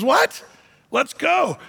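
high-pitched, incredulous rallying cry; eager urging. Retold or imitated. Caleb to the reluctant spies, eager urging. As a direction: act out an eager call to move.

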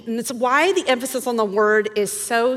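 ordinary talk, nothing marked

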